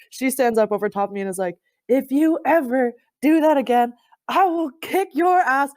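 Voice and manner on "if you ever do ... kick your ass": high, loud, furious threat in an imitated voice; retold threat. Teammate standing over her after a tackle, a loud high angry threat. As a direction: bark it down at the player you just flattened. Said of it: "she had such a mouth".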